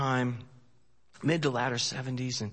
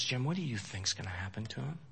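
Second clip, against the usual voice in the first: retold whisper; 10 dB quieter, faster than usual. Retold mother, upset.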